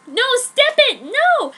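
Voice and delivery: high, loud shout of refusal; yelling. Acted. Heroine yelling at Edward to stop, high and loud. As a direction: yell it to push him away. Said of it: "I yelled".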